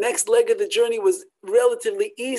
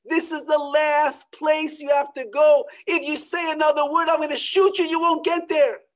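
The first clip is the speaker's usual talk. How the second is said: raised, higher, threatening smuggler; menacing smuggler shouting a warning; angry threat; 6 semitones higher, 3 dB louder than usual. Acted. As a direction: bark the threat at the family. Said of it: "this man is screaming".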